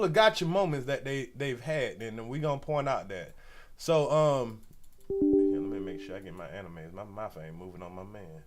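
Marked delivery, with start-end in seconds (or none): none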